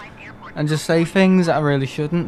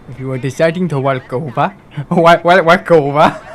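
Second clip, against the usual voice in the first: badly faked African accent; mock African accent; 5 dB louder than usual. Mimicking a weather forecast for a joke, mock African accent. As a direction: put on a mock African accent, breaking into laughter. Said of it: "It doesn't sound anything like it".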